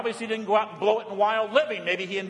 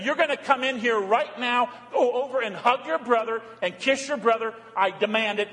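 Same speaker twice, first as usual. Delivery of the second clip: raised, commanding father's voice, somewhat louder; demanding father; 6 semitones higher, 4 dB louder than usual. Retold or imitated. Father ordering his resentful son inside, commanding. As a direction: lay down the law as a stern father would. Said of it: "He doesn't demand and say".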